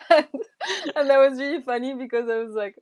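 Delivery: talking through real laughter; laughing. Real, not acted. Telling the guest story, laughing at it. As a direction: let the laugh spill into the words. Amused telling of never returning.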